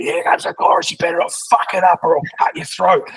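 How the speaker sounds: a hammed-up gangster warning the band; menacing mock threat. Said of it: "but it'd be".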